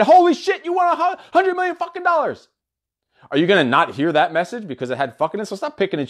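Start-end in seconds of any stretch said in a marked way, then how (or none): 0.0-2.5 much higher, thrilled outburst; excited. Retold or imitated. Telling a lottery winner the news, high and thrilled. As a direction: blurt it out like you just won the lottery. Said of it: "'cause I was so excited".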